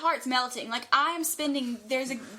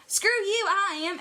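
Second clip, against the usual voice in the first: high, loud mock-indignant comeback; defiant retort; 9 semitones higher, 5 dB louder than usual. Fan retorting to an uninterested driver, retold defiance. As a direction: fire back as an offended fan.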